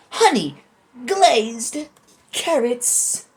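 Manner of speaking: announcer voice. Pretend. Announcing food like a showman.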